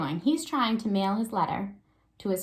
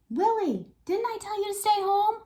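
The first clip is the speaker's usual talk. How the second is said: scolding Willie; 12 semitones higher, 4 dB louder than usual. A narrator's reading. Peter scolding dog in story.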